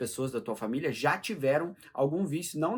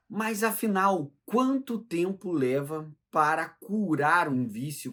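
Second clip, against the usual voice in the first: slow dramatic question; 3 semitones higher, 3 dB louder, slower than usual. Acted.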